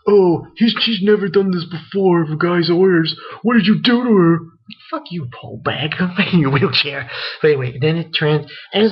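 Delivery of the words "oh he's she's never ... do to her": higher and louder, a loosely voiced upset girl; upset girl's voice. Girl in wheelchair confronting Shu, higher and louder. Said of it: "She grabs him and says".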